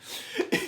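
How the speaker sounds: short unvoiced laugh after the bit; breathy laugh. Real, not acted. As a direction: laugh at your own joke. Laughs at own impression.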